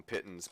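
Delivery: "pitons" as PIT-unz; habitual pronunciation. Shown as a demonstration. Game master giving own version, "pitons" said PIT-unz. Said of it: "I always call 'em".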